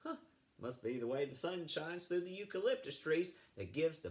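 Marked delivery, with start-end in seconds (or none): none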